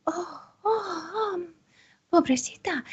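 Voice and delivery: gasp, then high, soft dismay; pitying healer. Acted. Healer seeing the wound on your chest, acted pitying voice. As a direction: coo with acted pity in a high voice.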